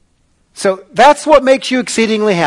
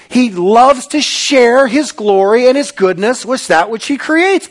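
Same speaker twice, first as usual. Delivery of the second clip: usual speaking voice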